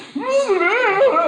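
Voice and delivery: high, loud, hammed-up whoop of a yell; wild rising scream. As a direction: let out an over-the-top victory yell. Mimicking the Dean scream.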